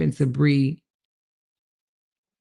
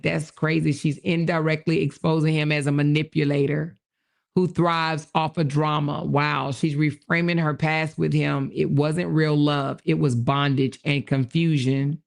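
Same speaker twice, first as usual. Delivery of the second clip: ordinary delivery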